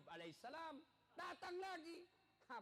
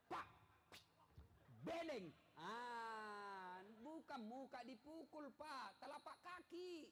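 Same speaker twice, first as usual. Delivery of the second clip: preacher's sharp rebuke; 4 semitones higher, much slower than usual. Real, not acted. Preacher scolds men who hit faces.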